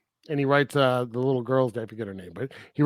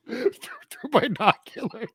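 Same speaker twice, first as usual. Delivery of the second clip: higher, squeezed through laughter; laughing; 8 semitones higher than usual. Real, not acted. Cracking up over spying from afar, laughing. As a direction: giggle the words out.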